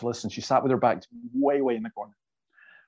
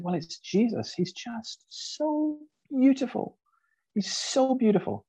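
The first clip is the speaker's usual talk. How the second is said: slow, much higher, tearful awe; tearful wonder; 11 semitones higher, slower than usual. Retold or imitated. Retelling the weeping student who found Jesus beautiful, tearful awe. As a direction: voice the crying girl lifted high and slow in wonder. Crying girl moved by Jesus.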